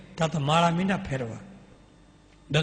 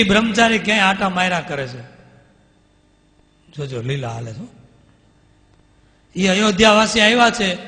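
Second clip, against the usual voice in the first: raised, louder grumbling voice; indignant complaint; 3 semitones higher, 7 dB louder than usual. Retold or imitated. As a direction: complain loudly that nobody attends. Muktanand Swami complains to Maharaj. (ઊંચા અવાજે ફરિયાદ)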